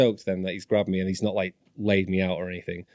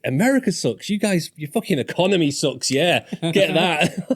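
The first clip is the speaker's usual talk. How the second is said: retold taunting retort; 8 semitones higher, 4 dB louder than usual. His retort in a retold row.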